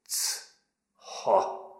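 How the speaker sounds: breathy, scornful little scoff. A narrator's reading. The recruit scoffing at Péter's advice, scornful. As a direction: dismiss it with a scoff. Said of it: "mondta fitymálva".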